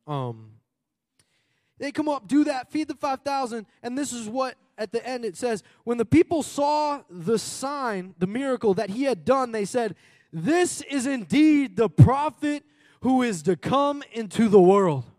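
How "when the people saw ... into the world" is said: loud, slow preaching, rising to a sung cry; preached scripture reading. Acted. Preacher reading scripture to the church, loud and slow. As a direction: proclaim it to the church at full force.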